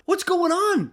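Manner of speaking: high, loud retold confrontation; urgent demand. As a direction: demand an answer, voice jumping up. Confronts her after days missing.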